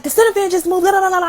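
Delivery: high babbling mock panic; fake hype. Scared caller tells mama what happened, babbling panic. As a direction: pitch it up and dissolve into jabber. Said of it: "I'm fake hype right now".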